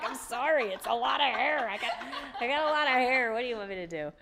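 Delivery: high, giggly and sheepish; laughing apology. Retold or imitated. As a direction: laugh through the excuse. Apologizing about her hair.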